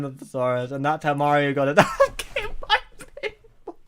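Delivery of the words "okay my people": laughing. Real, not acted. Laughing at the story.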